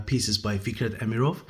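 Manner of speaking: usual speaking voice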